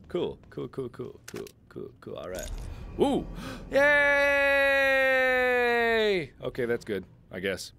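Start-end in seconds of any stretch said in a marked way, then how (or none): 3.0-6.3 high, drawn-out yay; sustained high celebratory squeal; long cheer. Real, not acted. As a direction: cheer and hold the note. Celebrating success in the game.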